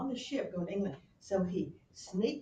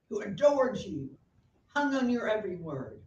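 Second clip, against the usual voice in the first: louder, higher accusing Hamlet; loud, bitter reproach; angry Hamlet; 4 semitones higher, 11 dB louder than usual. Retold or imitated. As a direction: hurl it at his mother. Hamlet berating his mother.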